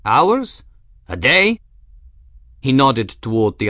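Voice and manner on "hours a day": taunting. Acted. Siriaco's taunting questions.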